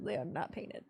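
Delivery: ordinary talk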